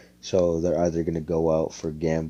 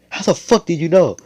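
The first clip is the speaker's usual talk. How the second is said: much higher and louder, caught red-handed; shocked outburst; 11 semitones higher, 10 dB louder than usual. Retold or imitated. Yami and Magna caught going gambling, shocked high loud outburst. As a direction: blurt it out, stunned they were found out.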